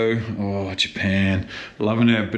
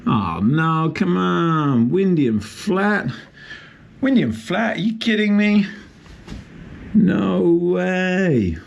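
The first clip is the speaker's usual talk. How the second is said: frustrated disbelief; 7 semitones higher than usual. Real, not acted. Surf check finds flat sea.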